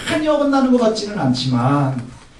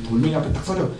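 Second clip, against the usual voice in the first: normal talking voice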